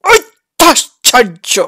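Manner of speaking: "অত্যাশ্চর্য" squeezed out slowly, syllable by syllable; "অত্যাশ্চর্য" in slow, forced bursts, imitating someone straining; mock straining. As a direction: push hard on each syllable of "অত্যাশ্চর্য", mockingly. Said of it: "এমন চাপ দিয়ে বলেছেন".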